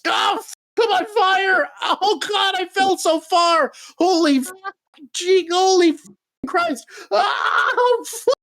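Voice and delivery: hammed-up panic, burning man cursing as he falls; Guts on fire. Guts yelling while he falls burning into the fire. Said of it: "And then cut to Guts".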